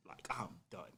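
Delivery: breathy whisper, fed up and finished; whispered I'm done. Retold or imitated. Telling his girl he's done, whispered and drained. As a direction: let it out in a whisper like you're finished.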